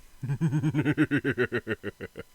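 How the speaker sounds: long gloating villain laugh; evil villain laugh. Acted. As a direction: cackle like a fighting-game villain. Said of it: "his evil villain laugh sounds a lot like Seth's laugh".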